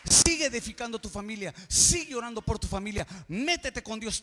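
loud, soaring preacher's cry; rally the congregation at full voice; preaching at full force. Acted. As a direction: revival preacher shouting the scripture. Preacher quoting Paul, rousing church.

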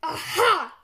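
loud gotcha exclamation; triumphant cry. A narrator's reading. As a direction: pounce on the culprits with a loud cry. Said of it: "cried Mister Crump".

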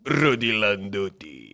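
slow, drawn-out mock name; mock Lula. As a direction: stretch the nonsense word slowly. Impression nonsense word.

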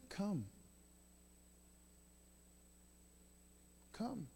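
very soft father's invitation; almost hushed, unbothered beckoning; gentle welcome. Acted. Father to hesitant child, hushed and welcoming.

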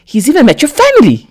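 put-on jealous boyfriend suddenly shouting at her. A narrator's reading. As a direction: explode out of nowhere. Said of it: "he suddenly shouts".

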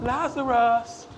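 slow, mock-gentle coaxing call; softly sung-out pleading, making fun of timidity. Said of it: "It was not".

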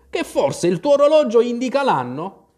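high, loud challenging question; grumbled retort. A narrator's reading. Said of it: "borbottò il cappellaio". (replica polemica e brontolata)

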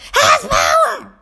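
high, drawn-out mock shout; mimicked kid yell, stretched out; imitating yelling student. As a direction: holler it back slowly like an overexcited pupil. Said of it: "walking around the room yelling".

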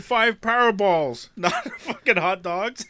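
slow, high mock irritation, laughing midway; retold pissed-off customer. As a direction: imitate an annoyed customer correcting the clerk. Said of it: "pissed off, like".

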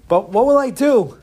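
touch of helpless panic; dismayed boy. Acted. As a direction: wail lightly like a stranded child. Stranded boy despairs.

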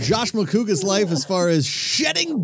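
hammed-up live TV host voice; mock announcer. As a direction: present it like a ringside announcer. Mock announcer patter about own body hair.

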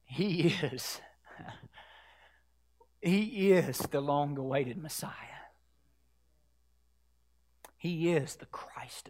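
low, near-whispered reverence; soft words with long silences between them; hushed awe. Real, not acted. Preacher revealing the long-awaited Messiah, hushed awe.

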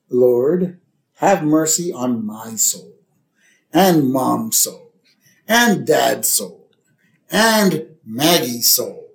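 slow, solemn mock prayer; Lisa. Lisa saying grace for family souls, slow solemn prayer. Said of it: "And Lisa says".

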